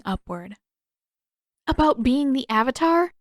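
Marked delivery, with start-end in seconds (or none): 1.7-3.1 exasperated. Acted. Exasperated sigh before.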